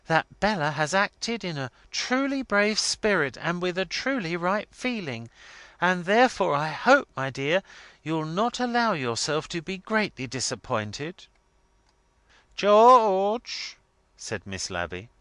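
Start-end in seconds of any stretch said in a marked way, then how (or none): 0.1-11.3 higher-voiced, deferential pleading; submissive. A narrator's reading. R W defends his daughter to his wife, submissive. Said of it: "added R W submissively". 12.5-13.8 high, loud, drawn-out warning call; sepulchral warning. A narrator's reading. Miss Lavvy copying her mother, sepulchral warning. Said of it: "in a sepulchral warning voice".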